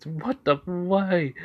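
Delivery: a bit of shocked disbelief; startled. Acted. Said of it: "the guy says".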